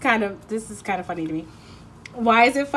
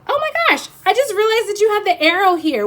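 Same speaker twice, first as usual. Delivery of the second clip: much higher, sudden surprise; surprised; 12 semitones higher, 8 dB louder than usual. Real, not acted. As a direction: gasp it out, startled. Sudden realisation about a card.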